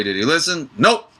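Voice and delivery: high, loud, fed-up punchline; exasperated woman. Retold or imitated. The woman explains why she's done crying, exasperated. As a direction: toss off the Nope sharply. Woman scolds Yosef for not listening.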